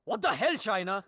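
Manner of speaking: heated, disbelieving outburst; angry disbelief. Acted. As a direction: demand an explanation angrily. Angry at her confession.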